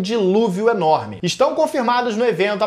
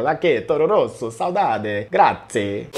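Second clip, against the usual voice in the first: put-on Italian accent; 9 semitones lower, slower than usual. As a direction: roll it out like an Italian with hand gestures. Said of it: "adoro falar com sotaque italiano".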